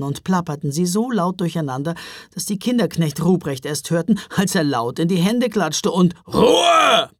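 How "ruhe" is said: high, loud bellow; booming one-word command; roar. A narrator's reading. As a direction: bellow for silence. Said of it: "brüllte".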